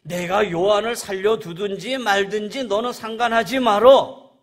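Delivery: loud, high, forceful command; cutting rebuke. Acted. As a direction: cut him off at full voice. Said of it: "딱 자르는 말씀".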